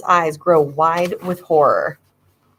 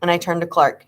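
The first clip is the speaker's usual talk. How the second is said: plain reading voice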